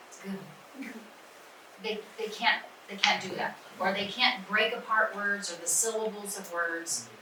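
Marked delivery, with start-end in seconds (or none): none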